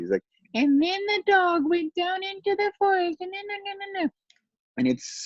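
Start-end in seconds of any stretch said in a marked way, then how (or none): 0.5-4.1 put-on very high, childlike voice of a ten-year-old; cute little boy voice. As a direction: imitate your small son reading his audiobook. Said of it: "in his cute little ten-year-old voice".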